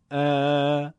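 ا held long and level; long alef. Shown as a demonstration. Teacher contrasting alef maddi with fatha, prolonged. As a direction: stretch ا to double length. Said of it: "دو برابر".

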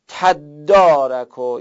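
slow تدارک, "taddārak" with doubled دّ. Shown as a demonstration. Teacher applying rule three to تدارک, slowly. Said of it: "قاعدهٔ سه میگه که".

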